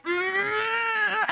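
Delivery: long, high mock "mm" of uncertainty; drawn-out imitated thinking noise; puzzled hum. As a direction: hum high while failing to place someone. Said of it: "And he's like".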